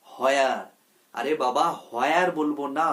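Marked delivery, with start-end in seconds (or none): 0.1-0.6 "হোয়ার" with a sounded h, "ho-ea"; mispronounced h. Shown as a demonstration. Teacher showing learners the common mistake, "হোয়ার" with added h. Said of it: "হ আসবে না". 1.9-2.3 "হোয়ার" with a sounded h, "ho-ea"; mispronounced h. Shown as a demonstration. Teacher showing learners the common mistake, "হোয়ার" with added h. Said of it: "হ আসবে না".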